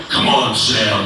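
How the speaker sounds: deep voice. Retold or imitated. Imitating the actor's deep voice.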